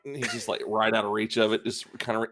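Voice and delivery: usual speaking voice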